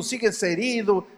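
normal talking voice

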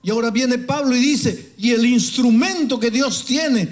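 loud, high preaching at full force; fiery preaching. Acted. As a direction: thunder it from the pulpit. (predicación a todo pulmón, fuerte y aguda)

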